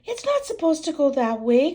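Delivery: high, loud upset cry. Acted. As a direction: wail it like a frustrated child. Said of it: "cries Peppa".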